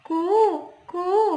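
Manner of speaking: high, light "कू, कू" like a koel; कोयल की मधुर कूक; sweet cuckoo call. Shown as a demonstration. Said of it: "कोयल कैसे बोलती है बच्चों".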